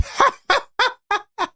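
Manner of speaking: high-pitched burst of laughter; laughing. Real, not acted. Streamer cracks up after McCain's three, laughing. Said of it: "The only reason I'm laughing".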